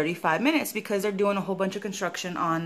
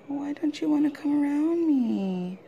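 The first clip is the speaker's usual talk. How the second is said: slow, high coaxing voice to the dog; sweet-talking the pet, drawn out; 6 semitones higher, slower than usual. Real, not acted.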